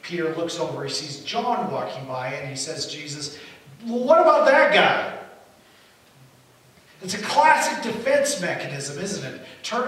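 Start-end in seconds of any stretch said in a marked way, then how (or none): 2.9-5.2 loud, high acted objection; raised voice, shifting the attention away; indignant deflection. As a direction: burst out, pointing at someone else. Acts Peter deflecting onto John.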